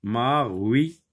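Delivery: normal talking voice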